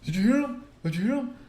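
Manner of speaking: high, loud, pleading tattletale voice; mocking the coworker begging the boss to back him up; whiny coworker. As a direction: whine it to the boss like a snitch. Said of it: "He's like".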